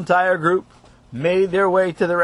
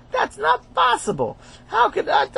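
squeaky high outraged voice; high-pitched disbelief. Acted. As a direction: shoot way up in shock.

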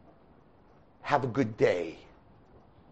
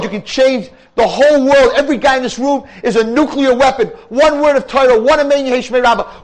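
much higher and louder rousing sermon; fiery preaching; 9 semitones higher, 8 dB louder than usual. Real, not acted. Preaching at full force.

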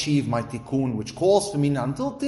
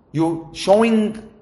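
you're showing dragged out, higher and louder; slow emphatic stress; 7 semitones higher, 5 dB louder, much slower than usual. Real, not acted. Preacher hammering the point home to listeners, slow and loud. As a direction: lean hard on you're showing.

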